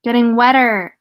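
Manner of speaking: emphatic, sure delivery; confident retake. Real, not acted. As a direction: land the words now you have got them. Repeats the line once understood.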